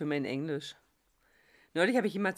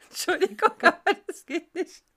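laughing while talking; 9 semitones higher, 5 dB louder than usual. Real, not acted. Laughing through her protest.

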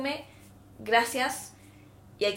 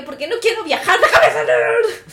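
much louder and higher, slow, laughing; exasperated laughing plea; 11 semitones higher, 13 dB louder, slower than usual. Retold or imitated. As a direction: beg the officer to let you out. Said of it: "¿Por qué?".

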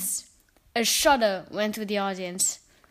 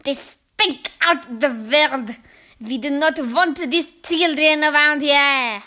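loud, slow witch tirade; screaming witch; 2 semitones higher, 8 dB louder, slower than usual. A narrator's reading. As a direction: drag the words out in furious disgust. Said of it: "she screamed".

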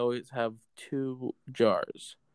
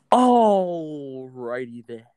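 gasp, then a very slow, louder, stretched-out drawl; Jim Carrey impression; 6 dB louder, much slower than usual. Host's turn in the game, goofy and stretched. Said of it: "Jim Carrey".

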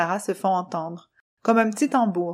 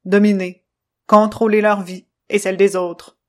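loud, clipped machine voice, stern; choppy robot voice; 5 dB louder than usual. Acted. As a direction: stiff robot speaking in jerks. Controllo's presentation.

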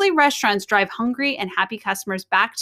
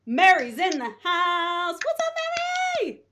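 excited announcer; 8 semitones higher than usual. Real, not acted. Host hypes guest's entrance.

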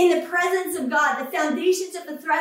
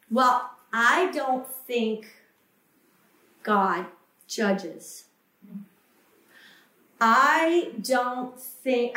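mocking lax believer; 3 semitones lower than usual. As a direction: drag it out like a self-excusing skeptic. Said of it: "we've got this thing".

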